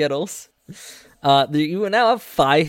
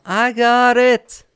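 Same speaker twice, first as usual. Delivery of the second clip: put-on high, loud child's call; little kid voice; 10 semitones higher, 7 dB louder, slower than usual. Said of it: "you hear a little voice go".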